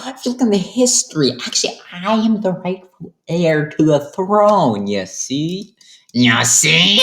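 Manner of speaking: hammed-up smug king; pompous king voice. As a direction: lord it over them. King Carlos voiced, boasting.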